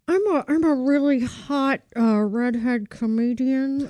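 slow, drawn-out put-on voice; slow impression of a redhead comedian. Shown as a demonstration. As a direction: stretch the words like a lazy, self-satisfied comic. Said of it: "do mine. I don't know what it's gonna turn into".

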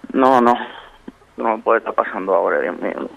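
ordinary talk, nothing marked

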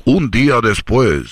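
very slow, low dramatic announcement; slow narrator voice. Acted. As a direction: stretch it out like a movie title card. Narrator time skip card. (voz de narrador lenta y grave)

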